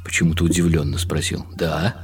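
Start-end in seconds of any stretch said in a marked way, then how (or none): none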